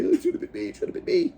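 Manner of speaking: hammed-up sulky whine; mock whining. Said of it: "I don't sit here and say".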